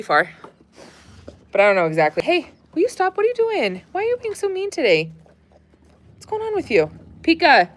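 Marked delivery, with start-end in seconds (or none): 2.2-7.7 high voice scolding a dog; high scolding pet voice. Real, not acted.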